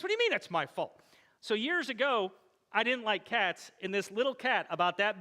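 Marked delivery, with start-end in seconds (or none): 0.0-1.0 indignant wife. Retold or imitated. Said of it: "And she goes".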